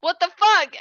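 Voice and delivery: loud, high mock shock; shocked outburst. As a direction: burst out as the startled chaperone. Chaperone reacting to surprise kiss.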